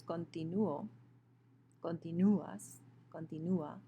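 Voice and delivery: "continuo, continuas, continua" with the U stressed. Shown as a demonstration. Teacher showing students the weak-vowel accent, U stressed in "continuo, continuas, continua". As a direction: lean on the U in "continuo, continuas, continua", not the last syllable. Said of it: "it is the U that has the stress".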